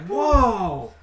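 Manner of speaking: high, wowed exclamations; acted amazement. Game master voices a woman believing a tall battle tale, acted amazement. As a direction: gush in awe, pitched well up.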